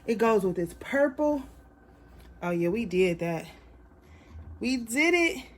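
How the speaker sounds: ordinary talk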